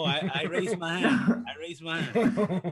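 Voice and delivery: words broken by laughter; laughing. Real, not acted. Co-host laughs while owning his remark, laughing. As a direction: admit it while cracking up. Laughing defense of own comment.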